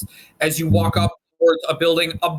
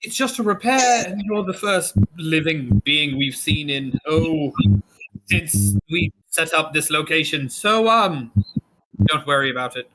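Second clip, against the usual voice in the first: lightly voiced, easygoing shopkeeper refusing money; friendly tiefling shopkeeper waving off payment. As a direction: brush off the customer's coins kindly. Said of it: "he says".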